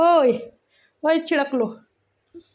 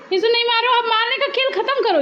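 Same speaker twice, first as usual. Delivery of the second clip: high, firm parental command; exasperated mother calling a halt; scolding; 7 semitones higher than usual. Real, not acted. Mother orders child to stop hitting.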